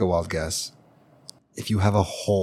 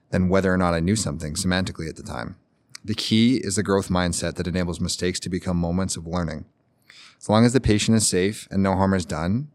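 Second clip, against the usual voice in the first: reading aloud. Acted. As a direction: read the submitted advice out, presenting it. Reads out submitted advice.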